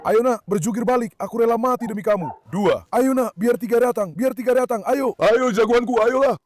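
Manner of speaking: root for your champion pigeon; excited cheering. Real, not acted. Keeper cheers his tumbling pigeons, high and loud.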